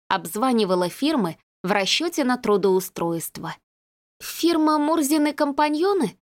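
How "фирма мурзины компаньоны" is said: put-on young woman on the phone. A narrator's reading. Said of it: "проговорила она".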